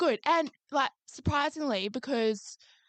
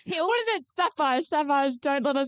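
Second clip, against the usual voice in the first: higher, broad Aussie Sharon voice; Sharon impression; 4 semitones higher than usual. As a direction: squeal it like Sharon from Kath and Kim. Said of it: "Sharon with her fucking netball uniform".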